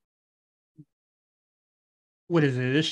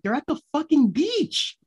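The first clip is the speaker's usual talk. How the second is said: exasperated retort; 12 semitones higher than usual. Retold or imitated. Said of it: "I was just like".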